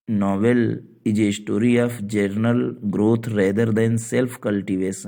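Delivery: English definition read very slowly; slow dictation. Shown as a demonstration. Teacher dictates the English definition slowly to students. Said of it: "reads definition slowly".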